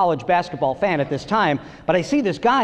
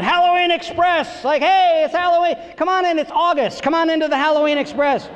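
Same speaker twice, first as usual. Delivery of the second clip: pitchman; 8 semitones higher than usual. Pretend. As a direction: hawk it like a cheesy carnival barker. Carnival barker for pop-up store.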